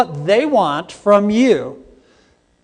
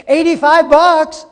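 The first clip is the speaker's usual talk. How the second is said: much higher, louder, stretched outrage; shocked outrage; 10 semitones higher, 6 dB louder than usual. Retold or imitated. Lady balks at the carpenter's bill, high and loud. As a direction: balk at the price, up high and loud. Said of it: "she looked at the bill and she said".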